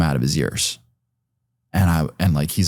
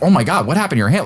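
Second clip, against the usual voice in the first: higher, fast, alarmed caring dad; genuine concern; 8 semitones higher, faster than usual. Retold or imitated. Dad spotting his son's hurt hand, high and fast with concern. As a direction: rush it out like a worried father. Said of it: "with legitimate concern".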